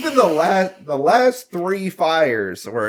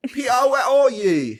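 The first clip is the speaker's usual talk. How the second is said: mock calling out; 12 semitones higher than usual.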